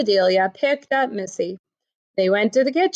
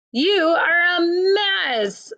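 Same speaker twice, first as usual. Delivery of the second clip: put-on higher, slow doting baby talk; fond teasing; 7 semitones higher, much slower than usual. Amelia fondly teasing messy Missy, slow and higher. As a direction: coo it slowly at the baby.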